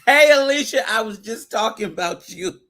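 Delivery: high, loud delighted call-out; loud, high start easing slightly; excited greeting. Real, not acted. Excitedly calls out to Alicia.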